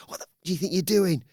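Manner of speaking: high, ranting boss voice; shrill-free high mock telling off; mock angry Pearce. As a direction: do Adam Pearce losing it, pitched high. Pearce confronts Bron in story.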